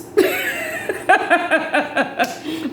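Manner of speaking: a long high real laugh. Real, not acted. Laughing about the on-sight joke, high laugh. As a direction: crack up at the joke.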